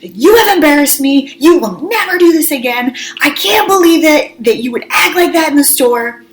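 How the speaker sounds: re-enacted furious mom lecture; retold angry screaming. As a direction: tell the kids off, outraged. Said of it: "I just start screaming at them".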